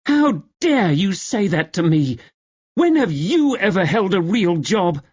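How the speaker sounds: high, sharp angry comeback; furious retort. Acted. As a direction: snap back in outrage. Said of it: "Gwyneth glared at her".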